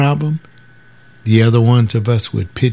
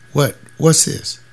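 ordinary delivery

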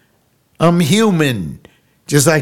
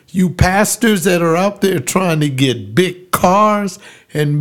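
slow, higher preacher's cry; preaching fervor; 3 semitones higher, slower than usual. Retold or imitated. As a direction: preach it out to the whole courtroom. Pastor preaching in the courtroom.

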